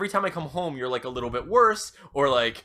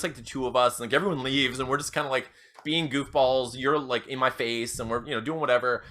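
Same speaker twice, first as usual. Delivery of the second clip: usual speaking voice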